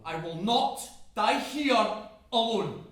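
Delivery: slow, loud, high defiance; defiant shout. Acted. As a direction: burst out with each word drawn out. Resolve bursts into a shout.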